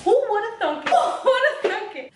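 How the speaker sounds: mock amazement through laughter; amused, sarcastic laugh-line; laughing sarcasm. Real, not acted.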